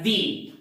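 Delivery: "the" said like "dee"; "the" as thee. Shown as a demonstration. Teacher concludes for students, "the" as thee. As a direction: give "the" an ee vowel, as in see. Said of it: "you have to pronounce this word as".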